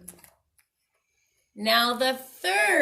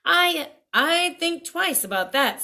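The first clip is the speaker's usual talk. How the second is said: higher, louder stammering man; doubtful seller stumbling over words; hesitant man; 7 semitones higher, 6 dB louder than usual. Acted. Stick seller warning the pig, hesitant and louder.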